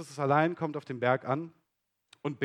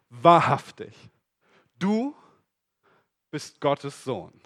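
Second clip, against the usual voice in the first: slow, louder awestruck declaration; awed confession; 4 dB louder, slower than usual. Acted. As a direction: proclaim it in wonder. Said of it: "warfen sich vor ihm nieder".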